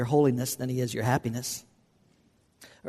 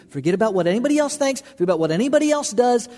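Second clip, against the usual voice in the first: higher, louder and fast, pressing the point; fervent quickened exhortation; urgent preaching; 8 semitones higher, 4 dB louder, faster than usual. Acted. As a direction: urge them hard to forget the crowd.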